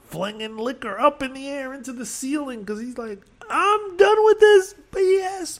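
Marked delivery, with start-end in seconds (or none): 3.5-5.6 very high, slow imitated shout; mock railing. Bride's father flinging liquor, imitated railing. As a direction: yell it like a fed-up father.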